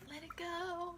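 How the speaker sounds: lightly sung-out high line, playful; sing-song high. Pretend. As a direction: toss it off high like a song snippet.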